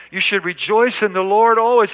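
ordinary talk

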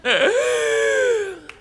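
a sharp, high crying gasp. Acted. Acting out a despairing prayer for the church, sobbing gasp. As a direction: gasp out loud as the tears break through. Tearful doubting prayer breaks off.

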